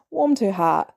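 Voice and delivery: usual speaking voice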